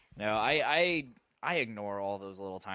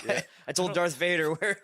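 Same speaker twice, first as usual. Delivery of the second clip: laughing through a meme quote; 8 semitones higher, 7 dB louder than usual. Retold or imitated. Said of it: "the meme where it's like".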